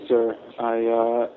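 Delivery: usual speaking voice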